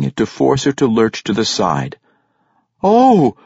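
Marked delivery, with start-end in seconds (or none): 2.8-3.4 high, loud cry of surprise; startled "Oh!", much higher and louder; pained exclamation. A narrator's reading. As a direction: exclaim sharply in surprise and pain. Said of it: "she exclaimed, catching her breath".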